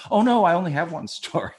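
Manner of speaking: retold flustered confession; mock dismay. Retelling his alarmed confession.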